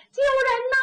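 high, long-drawn call for help; running cry for help. A narrator's reading. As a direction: call out for rescue while fleeing. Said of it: "一边跑一边喊". (边跑边高声呼救)

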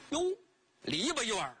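plain reading voice

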